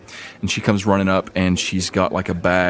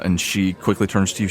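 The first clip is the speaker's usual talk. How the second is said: ordinary talk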